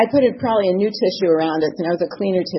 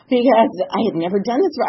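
usual speaking voice

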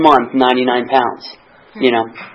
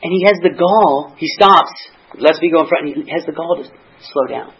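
normal talking voice

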